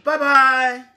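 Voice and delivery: high bird bye-bye. Pretend. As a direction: chirp a goodbye like a little bird. Bird says goodbye in story.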